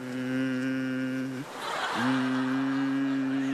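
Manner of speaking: deep grunt. Pretend. Security guard staring down from roof.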